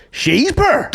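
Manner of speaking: high-pitched mock outrage; mock indignation. Reacting to friend's sushi story, mock indignation. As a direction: throw it back high, as if insulted. Mocks being called poor by friend.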